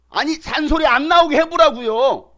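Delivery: usual speaking voice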